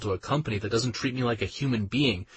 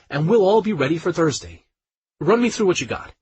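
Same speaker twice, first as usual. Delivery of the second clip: higher, louder, fast and businesslike; higher, louder boss-like colleague briefing him over video; brisk colleague; 5 semitones higher, 5 dB louder than usual. Acted. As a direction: push him like a no-nonsense coworker. Anne voiced on a video call.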